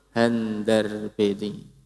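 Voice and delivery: "Handar beni" drawn out slowly, each syllable held; slow term demo. Shown as a demonstration. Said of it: "Nek cara basa Jawane apa?". ("Handar beni" diucapke alon-alon)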